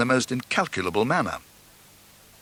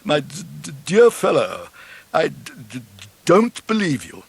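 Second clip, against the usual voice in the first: lightly voiced stammering disbelief, a bit higher; stammering angler; 4 semitones higher than usual. Angler back from club dinner doubts fish story, mild stammering voice. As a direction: stumble over the d's, a mild tipsy stammer. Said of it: "addressed by an angler returning from a club dinner".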